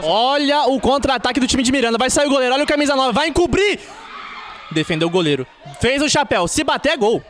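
rising, yelling play-by-play to the goal; explosive goal commentary. Real, not acted. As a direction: build the call up to a roaring golaço. Live counterattack goal call. (narração de gol explosiva)

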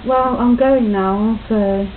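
ordinary delivery

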